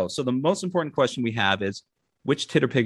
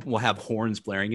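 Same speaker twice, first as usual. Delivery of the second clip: normal talking voice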